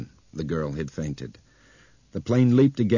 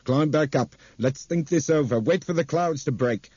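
higher, pleading and tense; strangled, frightened plea; tight strangled voice; 8 semitones higher, 4 dB louder than usual. A narrator's reading. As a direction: choke the words out through fear. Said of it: "tight, strangled sound".

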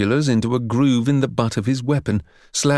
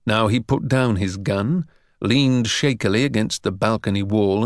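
ordinary delivery

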